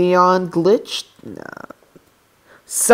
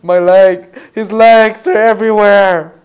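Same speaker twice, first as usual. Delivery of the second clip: loud, higher cry of dismay; horrified cry; 4 semitones higher, 8 dB louder than usual. Real, not acted. Player seeing legs fly off in game, horrified cry. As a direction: cry out in mock agony.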